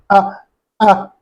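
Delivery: "Ah, ah." with a hard stop in the throat each time; glottal stop demo. Shown as a demonstration. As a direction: clip each "Ah" with a glottal catch. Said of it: "Hamza has to make a stop here".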